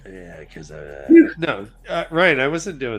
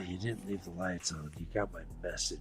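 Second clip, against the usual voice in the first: quiet; 8 semitones lower, 16 dB quieter than usual. Retold or imitated. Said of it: "He's like".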